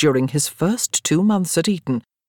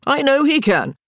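much higher, louder tipsy cheer; excited exclaim; 10 semitones higher, 4 dB louder than usual. A narrator's reading. Charles cheering drunkenly for Daniel, excited. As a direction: shout support for your friend. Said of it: "Charles Dunwoody exclaimed".

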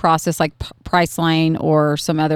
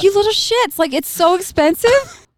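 mock exasperated mom; 13 semitones higher than usual. Mom exasperated at ungrateful kids.

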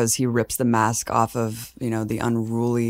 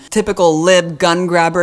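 mock dismissal; 4 semitones higher, 6 dB louder than usual. Mimicking dismissive critics.

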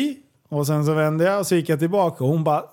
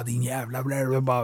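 low, soft mock hiss; imitated hissing; 5 semitones lower, 7 dB quieter than usual. Mimicking the woman picking up a can, mock hissing. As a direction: mumble a hissed insult into nonsense sounds. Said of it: "väser och grejer".

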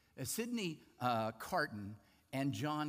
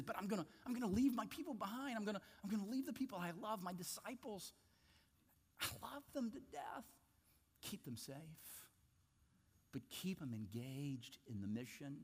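earnest prayer; 6 semitones higher, 8 dB quieter, faster than usual. Retold or imitated. Jesus praying for disciples.

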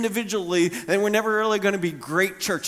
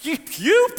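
mock indignant splutter; 10 semitones higher, 3 dB louder than usual. Disciples squabbling over who's greatest.

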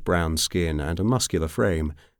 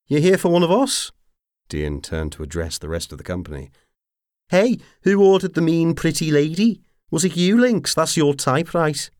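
high, loud mocking tease; teasing rogue; 12 semitones higher, 7 dB louder than usual. A narrator's reading. Dean teases his crew about the woman, mocking. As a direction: play to the crowd.

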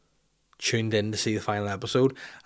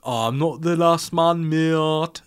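slow, raised Brummie put-on; higher-pitched fake Brummie; attempted Birmingham accent; 5 semitones higher, 4 dB louder, slower than usual. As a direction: drag the words in a bad Birmingham accent. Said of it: "Was that, like".

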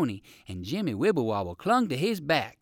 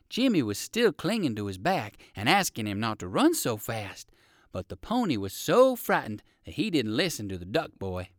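ordinary delivery

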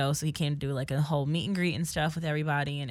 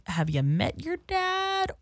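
high, slow, pointed sarcasm; pointedly patient teasing; mock-innocent question; 12 semitones higher, slower than usual. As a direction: draw it out as if asking the obvious. Teasing friend who claims no celebrities.

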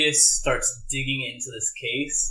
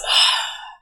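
loud, heavy sigh in character; acted sigh; 6 dB louder than usual. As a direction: let out a big audible sigh. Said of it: "Orpheus sighs".

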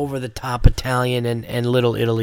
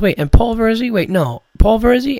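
high, questioning double take of a retold fan; surprised recognition; 9 semitones higher than usual. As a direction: imitate an amazed fan, pitched well up. Retold fan astonished at second comedian.

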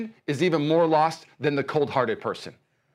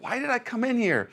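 higher and baffled; confused man talking to himself; puzzled self-quote; 8 semitones higher than usual. Retold or imitated. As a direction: ask yourself, lost in the room. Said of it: "I'm like".

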